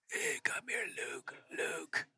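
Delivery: put-on soft voiceless whisper of a dying old master; dying Yoda. Yoda on his deathbed whispering to Luke, faint whisper. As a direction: breathe the words out like a last breath. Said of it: "I was talking like Yoda".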